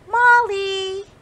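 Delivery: high, loud call across the snow. Acted. Sam and Pip call out to Molly, high and loud. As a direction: shout her name from down the hill. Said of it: "called Sam and Pip".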